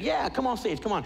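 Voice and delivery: high-pitched, startled invitation; shocked. Retold or imitated. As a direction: wave the kid up in happy shock. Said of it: "shocked. He's like".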